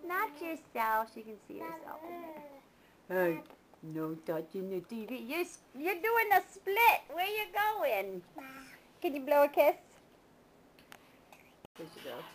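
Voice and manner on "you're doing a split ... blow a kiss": usual speaking voice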